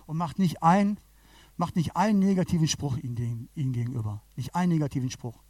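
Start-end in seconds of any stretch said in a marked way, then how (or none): none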